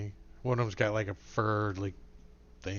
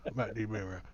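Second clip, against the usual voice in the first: softer trailing mumble; Boomhauer mumble; 3 semitones lower, 6 dB quieter, much faster than usual. Acted. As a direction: let it fade into a mumble. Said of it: "He talks like Boomhauer".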